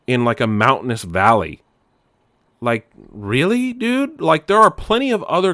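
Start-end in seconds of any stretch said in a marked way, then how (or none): 2.6-5.5 can't believe what he sees; incredulous. Real, not acted. Ranting at a bad game map.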